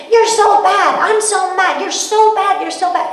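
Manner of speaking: high, loud imitation of a mother berating her child; hammed-up angry parent, hammering each phrase; scolding mother. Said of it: "this mother's like".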